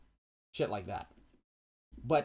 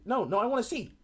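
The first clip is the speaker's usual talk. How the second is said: insistent protest; 9 semitones higher, 5 dB louder than usual. Retold or imitated. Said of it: "He's like".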